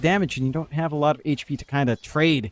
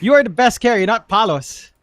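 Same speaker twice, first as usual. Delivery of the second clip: much louder, higher mock-hype reading; hyped-up chat read; 7 semitones higher, 7 dB louder than usual. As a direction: belt out the fan's praise like an announcer. Reading a fan's chat message.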